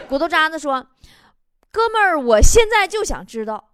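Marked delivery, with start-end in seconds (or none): 1.7-3.7 loud, emphatic mock complaint; aggrieved ranting. Man demanding who phoned him at midnight, loud aggrieved rant. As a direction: belt it out as an indignant grievance.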